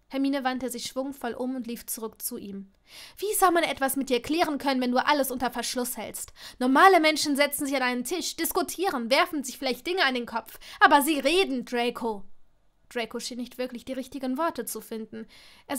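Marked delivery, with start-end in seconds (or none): 3.2-12.2 louder, emphatic argument; heated reproach. A narrator's reading. Hermine reproaches Draco for hiding everything, heated and loud. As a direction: lecture him in frustration. Turns back to argue with him.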